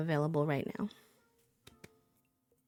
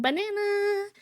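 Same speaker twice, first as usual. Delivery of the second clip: squealed banana; 13 semitones higher than usual. Pretend. Announcing winner playfully.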